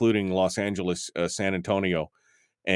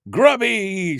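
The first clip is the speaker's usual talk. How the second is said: mock shout; 10 semitones higher, 6 dB louder than usual. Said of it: "says Bill".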